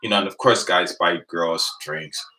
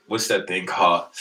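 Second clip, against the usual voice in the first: usual speaking voice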